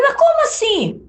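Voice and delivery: shocked, annoyed disbelief at the producer; indignant disbelief. Retold or imitated. Retelling the radio producer's call, shot up high in disbelief. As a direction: voice leaps high in retold outrage. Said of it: "meio chateada".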